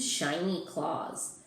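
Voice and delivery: plain reading voice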